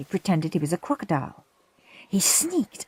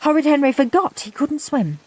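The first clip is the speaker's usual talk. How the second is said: usual speaking voice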